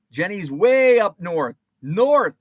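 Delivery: put-on Northern accent. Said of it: "I have a terrible Northern accent".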